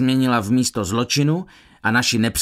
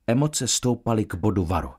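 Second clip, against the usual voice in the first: plain reading voice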